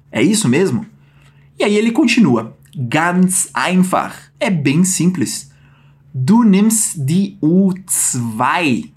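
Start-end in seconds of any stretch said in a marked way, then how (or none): 2.8-4.3 slow demo. Shown as a demonstration. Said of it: "teaching Hans's line". 6.1-8.9 very slow, every word spelled out; slow demo. Shown as a demonstration. Teacher slowly models the line for students. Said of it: "teaching Hans's line".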